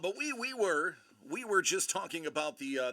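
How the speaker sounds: ordinary talk, nothing marked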